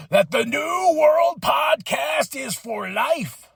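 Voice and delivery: slow, loud wrestling promo shout; catchphrase sign-off. Acted. To the viewers, loud hammed-up wrestler sign-off.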